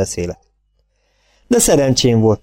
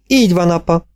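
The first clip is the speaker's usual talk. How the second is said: Olive's voice; 8 semitones higher than usual. A narrator's reading. Olive speaks.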